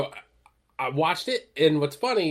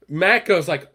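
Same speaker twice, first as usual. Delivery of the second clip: ordinary talk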